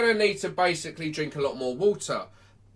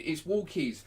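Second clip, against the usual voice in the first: ordinary talk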